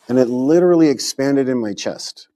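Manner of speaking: ordinary talk, nothing marked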